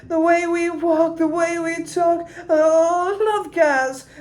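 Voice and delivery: high, slow, sloppy British croon; weird playful voice. Shown as a demonstration. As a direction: sing it high and a bit sloppy. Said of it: "Yeah, it's a weird voice".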